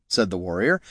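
ordinary talk

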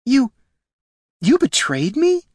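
slow, high-pitched stunned question; falter, then ask it wounded; hurt disbelief. Acted. Jelana realises she is betrayed.

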